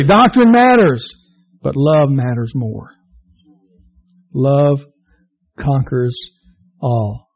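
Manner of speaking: preacher making his central point slowly; slow emphatic point. Real, not acted. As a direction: drive it home word by word. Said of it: "Listen to me clearly".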